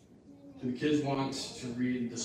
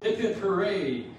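mock cheer; 8 semitones higher, 9 dB louder than usual. Shout quoted in rhyme.

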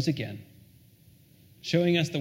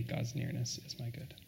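soft echo; 4 semitones lower, 13 dB quieter than usual. Real, not acted. Repeats quote much softer.

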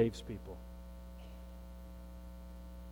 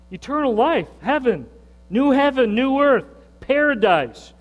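rising proclamation; 6 semitones higher, 4 dB louder than usual. Real, not acted. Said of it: "Say it, what?".

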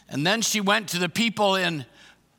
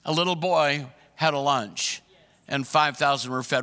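ordinary talk, nothing marked

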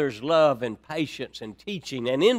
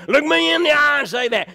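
a higher, louder, sharper mama's challenge; stern mother; 10 semitones higher, 6 dB louder than usual. Retold or imitated. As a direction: dare the child to repeat the lie. Stern mother scolding son.

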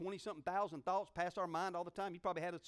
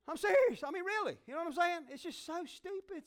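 emphatic insistence; 10 semitones higher, 6 dB louder than usual. Real, not acted.

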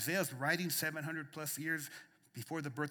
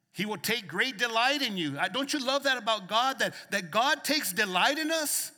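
rising preacher's cadence, louder on the peaks; preaching at full force; 7 semitones higher, 10 dB louder than usual. Real, not acted. Pastor preaching hope to the church, full force. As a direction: preach it out to the congregation.

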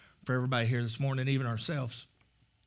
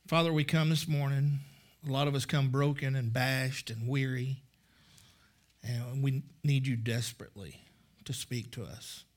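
heartfelt prayer. Real, not acted. Leads the congregation in prayer.

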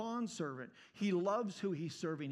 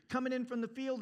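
ordinary delivery

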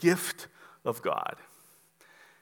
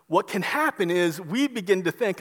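usual speaking voice

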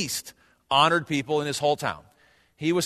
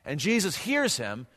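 ordinary talk

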